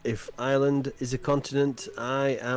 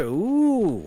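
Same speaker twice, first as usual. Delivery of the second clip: normal talking voice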